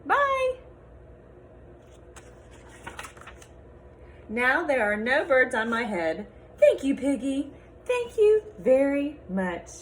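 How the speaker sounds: lively picture-book read-aloud with character voices; reading to children. Acted. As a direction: read it to a class of little kids.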